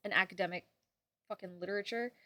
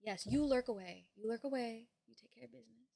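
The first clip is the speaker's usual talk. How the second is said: ordinary delivery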